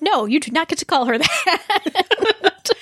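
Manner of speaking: high, drawn-out scolding of a TV character; retold indignant yell. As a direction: playfully re-enact an indignant protest. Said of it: "I yelled it at the screen".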